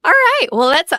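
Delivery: bright, upbeat segment kick-off; energetic host. Real, not acted. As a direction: launch the next segment with energy.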